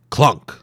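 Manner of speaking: loud clunk. A narrator's reading. Narrator voicing the marker falling. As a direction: punch a heavy thud. Sound effect in story.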